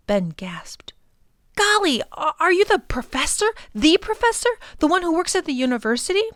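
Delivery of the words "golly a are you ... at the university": put-on much higher, excited child; gasping with awe at a famous man; rushed eager boy voice; amazed boy. Ben amazed.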